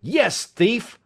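high-pitched angry retort; roared accusation. A narrator's reading. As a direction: throw the charge back at him. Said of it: "I roared".

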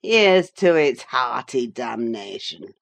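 blasphemous toast. A narrator's reading. Drunk father toasting damnation, low and slow. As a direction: raise the glass with grim relish. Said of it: "exclaimed the blasphemer".

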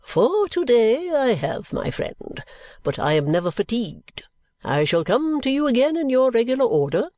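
high-pitched, showily cheerful lady; swooping high, determinedly bright; demonstrative cheerfulness. A narrator's reading. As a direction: sing it out with relentless, overbearing good cheer. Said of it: "returned Missus Pardiggle with demonstrative cheerfulness".

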